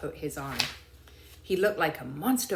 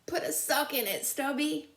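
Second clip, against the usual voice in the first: higher grumbling soldier voice; annoyed soldier; 10 semitones higher than usual. Acted. A soldier tells the howling dog to shut up, irritated. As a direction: snap at the noisy dog.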